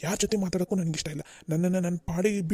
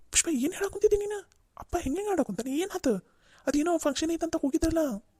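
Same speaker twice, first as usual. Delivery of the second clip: much higher, startled questions; alarmed son; 10 semitones higher than usual. Acted. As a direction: react in shock to the news.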